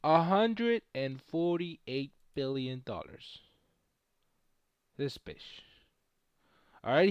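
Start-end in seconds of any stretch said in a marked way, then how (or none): 0.0-3.4 slow emphasis. Shown as a demonstration. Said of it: "Let me show you something".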